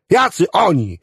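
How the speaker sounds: high, exasperated cry; agitated demand. A narrator's reading. Agitated Clevenger demands an answer.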